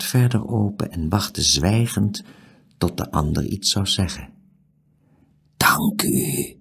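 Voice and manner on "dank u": breathy whisper from a stranger; hushed, voiceless thanks. A narrator's reading. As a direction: whisper it secretively. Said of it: "fluisterde de onbekende".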